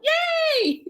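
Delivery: excited cheer. Real, not acted. Celebrating her race medal.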